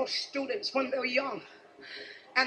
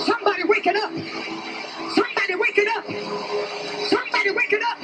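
preaching at full force; 13 semitones higher than usual. Acted. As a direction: whip the congregation into a shout.

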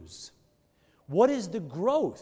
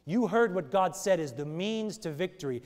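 ordinary talk

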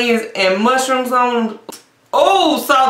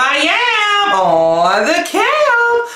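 excited greeting; 10 semitones higher, slower than usual. Real, not acted.

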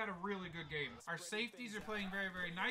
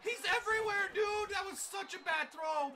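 excited yell; 11 semitones higher, 6 dB louder than usual. Real, not acted. Cheering another pick.

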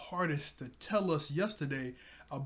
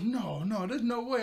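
disbelieving cry; 7 semitones higher, 4 dB louder than usual. Retold or imitated. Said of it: "She goes".

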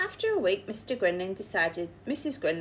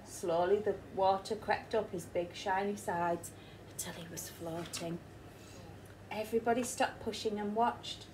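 ordinary delivery

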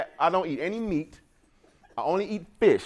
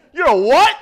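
higher, louder stunned "what"; shocked question; 7 semitones higher, 10 dB louder than usual. Retold or imitated. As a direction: throw it back in disbelief. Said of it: "They said".

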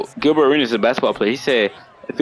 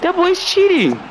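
accusing. Real, not acted. Complaining about opponent in game.

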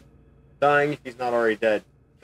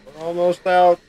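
calling out; 9 semitones higher, 7 dB louder, slower than usual. Acted. Rallying the group to escape.

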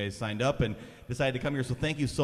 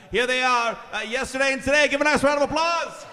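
much higher, loud showman announcement; Ed Sullivan shout; 12 semitones higher, 6 dB louder than usual. Acted. Host shouting the band onstage as Ed Sullivan, much higher. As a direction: blast the intro to the back row. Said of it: "do my best ... impersonation".

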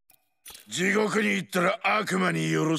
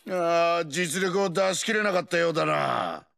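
ordinary talk, nothing marked

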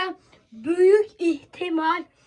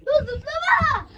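shouting; 7 semitones higher than usual. Pretend. Kid scolds during pretend quake.

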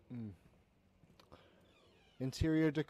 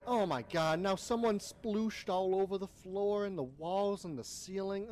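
ordinary delivery

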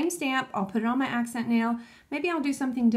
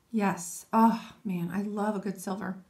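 ordinary talk

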